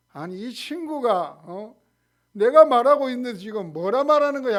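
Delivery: indignant, rising to a loud snap at the end; mock angry retort. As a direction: play the offended believer picking a fight. Acting out a quarrel with a mocker.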